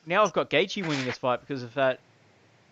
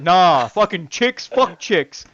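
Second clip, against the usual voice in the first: louder, slow mock brush-off; mock dismissal; 4 semitones higher, 7 dB louder, much slower than usual. Host imitating his co-hosts skipping the women's fights, mock dismissive. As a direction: imitate the guys dismissing it out loud. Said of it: "you guys are like".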